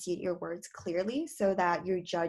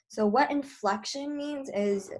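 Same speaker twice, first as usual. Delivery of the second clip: slow, raised, sing-song demonstration; lively inflection; 6 semitones higher than usual. Shown as a demonstration. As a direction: voice lifted high and slowed on "inflection". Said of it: "like, what I'm doing right now".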